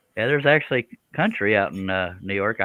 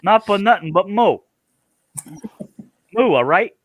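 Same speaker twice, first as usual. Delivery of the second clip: loud, high-pitched mock New York accent; New York cow; 9 semitones higher, 5 dB louder than usual. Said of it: "Do your best New York cow".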